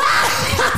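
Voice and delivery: high, gleeful villain cackle; gloating laugh. Acted. Joker mocking Batman, a gloating high cackle. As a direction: laugh with spiteful glee at him. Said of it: "mit dem hämischen Lachen".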